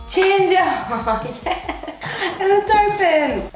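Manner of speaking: high delighted squeal; excited squeal. Real, not acted. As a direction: shriek with glee. Excited at ginger gift.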